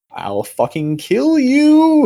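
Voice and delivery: high, drawn-out, loud mock threat; very dramatic. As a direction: melodramatic imitation of a stupid-sounding vow of revenge. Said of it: "she says it in a very dramatic".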